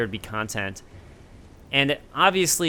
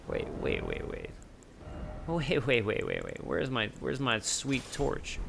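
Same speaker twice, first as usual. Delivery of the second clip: hushed; 10 dB quieter than usual. Real, not acted. Torch missing, hushed panic.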